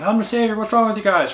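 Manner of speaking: high-pitched imitation of a frantic prisoner; mock screaming. Imitating the chained-up savior's outburst, mock screaming. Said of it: "like, screaming".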